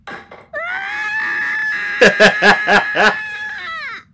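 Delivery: shrieking high laugh; screaming laugh. Real, not acted. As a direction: burst into a shrill scream-laugh. Huge reaction laugh.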